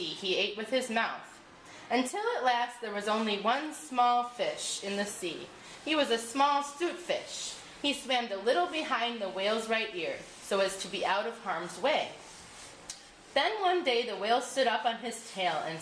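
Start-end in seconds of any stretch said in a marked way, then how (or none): none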